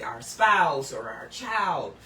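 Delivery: ordinary delivery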